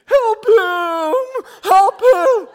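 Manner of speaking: very high, loud, drawn-out howl; second dog chiming in. Acted. Other dog joins the plea, howled cries. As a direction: howl the words like a neighbor dog. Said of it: "then the other dog chimes in".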